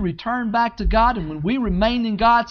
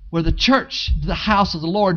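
ordinary delivery

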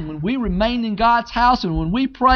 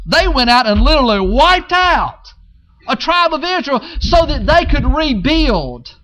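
loud, rising preacher cadence; preaching; 3 semitones higher, 3 dB louder than usual. Acted. Pastor urging the congregation to rebuild, preaching loud.

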